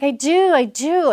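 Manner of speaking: mock little girl insisting; imitating daughter. Daughter answering her dad, eager childlike. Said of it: "And she's like".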